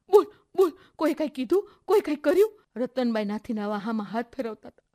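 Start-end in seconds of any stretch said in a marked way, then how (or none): 0.1-2.6 anxious coaxing. Acted. Women urge sobbing girl to speak.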